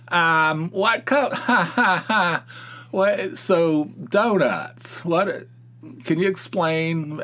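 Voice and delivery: high, halting, slow dithering customer voice; a hammed-up confused customer stalling at the counter; mock clueless customer. Mocking a confused customer at the donut counter, high and halting.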